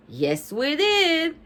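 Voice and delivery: high, slow, emphatic declaration; loud voice. A narrator's reading. Bean answering the first grader, mildly loud. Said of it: "in a loud voice".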